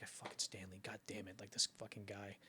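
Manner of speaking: low, hushed muttering under his breath; muttered grumble. Retold or imitated. Actor muttering to himself about the director, hushed grumble. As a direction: grumble it quietly, jealous and fed up.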